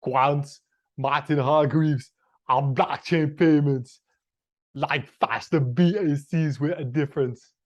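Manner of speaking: put-on Austrian accent drifting toward Jamaican; Arnold Schwarzenegger accent. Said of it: "now it's becoming a sort of a Jamaican".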